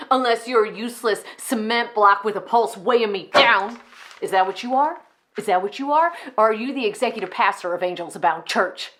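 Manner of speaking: loud, insistent interrogation; accusing tirade. Acted. Enid turns from Mama onto Johnny, accusing. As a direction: demand answers, turning on your underling. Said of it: "how insane they can sound at times".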